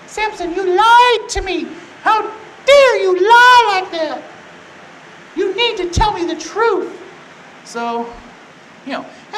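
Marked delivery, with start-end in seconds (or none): 0.1-7.0 high, loud, drawn-out scolding; hurt, mock outrage. Delilah scolding Samson for lying, hammed-up hurt outrage. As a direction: wail the accusation like a wronged woman. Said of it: "she's got her feelings hurt".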